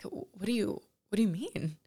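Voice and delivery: breathy whispered disbelief; barely able to get the words out; hushed and stunned. Retold or imitated. Retold stunned question to her guides.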